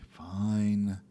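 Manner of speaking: ordinary talk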